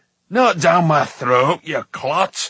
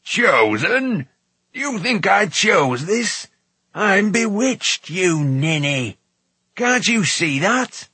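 slow, high, emphatic outrage; indignant dwarf's tirade; utterly exasperated; 7 semitones higher, 4 dB louder, much slower than usual. A narrator's reading. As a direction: burst out at a dimwit, word by word. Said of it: "in utter exasperation".

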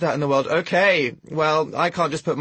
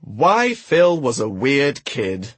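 announcer; 4 dB louder, much slower than usual. Acted. Reads chapter title.

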